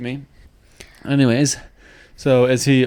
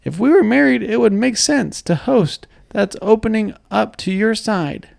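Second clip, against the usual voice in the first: cartoon character voice; 9 semitones higher than usual. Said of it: "like a cartoon character".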